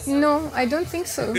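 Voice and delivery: a French mother's reply, playfully imitated; mother's French accent. French mother doubting he knows their friend, imitated French accent. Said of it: "she has this very strong French accent".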